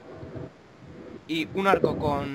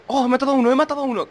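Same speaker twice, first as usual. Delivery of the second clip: quick thrilled shout, higher; excited fast cheer; 8 semitones higher, 5 dB louder, faster than usual. Real, not acted. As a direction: blurt it out in triumph. Excited kill in game.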